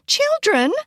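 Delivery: very high, incredulous echo; appalled. A narrator's reading. Girl recoiling at talk of motherhood, appalled.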